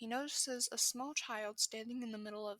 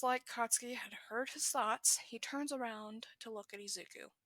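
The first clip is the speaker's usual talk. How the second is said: ordinary delivery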